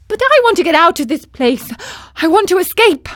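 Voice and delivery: high, loud and fast; high, fast, loud pleading; desperate puppet. A narrator's reading. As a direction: insist on getting out, urgently. Pinocchio voice.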